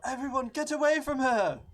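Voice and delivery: full-force, high shouted warning; yell. Acted. Player yelling out in character to protect someone, shouted. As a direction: shout it out over the noise of a fight. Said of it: "I yell".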